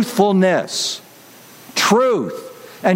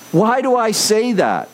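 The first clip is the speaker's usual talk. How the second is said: ordinary delivery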